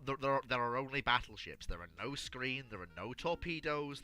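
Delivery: ordinary talk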